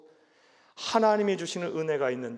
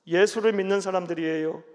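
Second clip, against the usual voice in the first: ordinary talk, nothing marked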